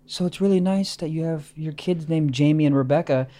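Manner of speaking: understated, quietly ominous drug dealer; calm veiled threat. Acted. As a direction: deliver a threat as polite small talk.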